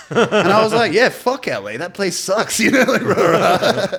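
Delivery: loud, high laughing talk; loud high laughter over the joke about L A. Real, not acted. Laughing at the misunderstanding.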